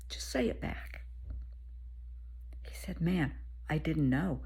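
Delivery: hushed whisper of advice; confiding whisper. Retold or imitated. Speaker quietly advising the dad to tell his son.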